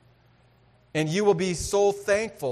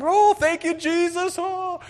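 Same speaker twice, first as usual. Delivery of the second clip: much higher, loud ecstatic cry of thanks; loud worship; 12 semitones higher, 6 dB louder than usual. Retold or imitated. Hunter thanking Jesus after the elk, loud ecstatic cry. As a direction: shout your joy to the sky. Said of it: "I'm worshiping God loud".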